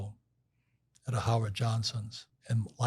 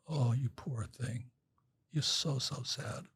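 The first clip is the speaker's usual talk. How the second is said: slow, softer, sarcastic sympathy; mock pity; 6 dB quieter, slower than usual. Best friend mocking his self-pity, slow and sarcastic. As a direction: drip fake pity before the kick.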